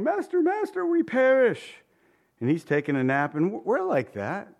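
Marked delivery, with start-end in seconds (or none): 0.0-1.8 much higher, frantic wail; panicked cry. Retold or imitated. Preacher voicing disciples screaming in the storm, much higher. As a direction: shriek it like the boat is going down. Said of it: "they're crying".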